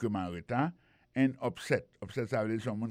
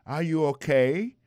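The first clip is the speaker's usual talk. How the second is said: loud, slow worried question; loud slow are you okay; concerned; 5 semitones higher, 8 dB louder, slower than usual. Shown as a demonstration. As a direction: ask with urgent concern.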